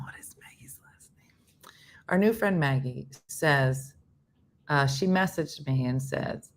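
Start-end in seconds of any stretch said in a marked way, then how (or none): 0.0-1.7 quick unvoiced whisper to herself; whispered aside. Real, not acted. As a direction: drop to a whisper mid-story. Aside while telling story.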